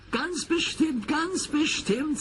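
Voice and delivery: put-on very high, bright children calling; children's voices. A narrator's reading. Children call after the departing engine, high and eager. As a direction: promise it eagerly like little kids.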